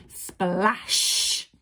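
loud, drawn-out splash; splash sound effect. A narrator's reading. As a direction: make it sound like a huge plunge. Giant falls from the bridge.

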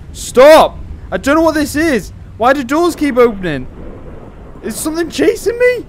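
loud, high-pitched alarm; panicked. Real, not acted. Player fearing something is chasing them, panicked. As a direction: cry out as doors open on you. Panics as doors keep opening.

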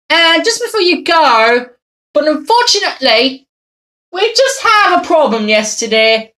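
ordinary talk, nothing marked

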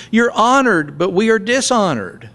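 high mock-humble irony; sarcastic. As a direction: flatter them with a sneer underneath. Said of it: "he gets sarcastic again".

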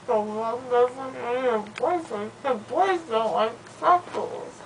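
loopy slurred rambling; drugged, drowsy talk; after wisdom teeth. Real, not acted.

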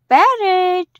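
ordinary talk, nothing marked

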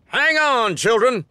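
loud, high, hearty cry over the gallop; boisterous shout. Acted. Colonel Roosevelt to the children on horseback, boisterous shout. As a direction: bellow it like a cavalry colonel leading the charge. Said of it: "he shouted boisterously".

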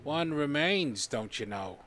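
slow mock Boston-Italian drawl; hammy Little Italy Boston voice; Boston-Italian accent. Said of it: "Sounds like Boston from Little Italy".

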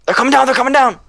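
high, alarmed warning; panicking gamer. Real, not acted. Enemies approaching, panicking.

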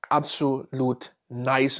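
slow Absolut nice, with the word nice drawn out and leaned on; stress on nice. Shown as a demonstration. Reviewer praising a perfume to viewers, stretching out nice. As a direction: lean into nice and let it linger. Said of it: "Und da liegt die Betonung auf nice".

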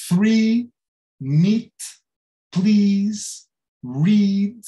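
three, meet, please, read, each with a held ee; long I sound. Shown as a demonstration. Teacher listing words with the I sound, each ee held. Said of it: "todas estas palavras têm um som I".

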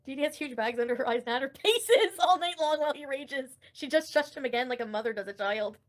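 usual speaking voice

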